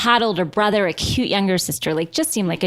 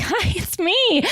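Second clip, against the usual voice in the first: flirty greeting; 11 semitones higher, much slower than usual. Retold or imitated. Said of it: "And I was like".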